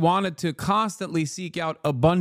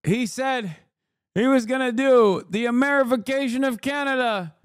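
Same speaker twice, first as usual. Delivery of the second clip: hammed-up victory speech, higher with each line; mock Carney gloating; 9 semitones higher than usual. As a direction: crow it like a triumphant rival, climbing to a yell. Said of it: "gonna come out and be like".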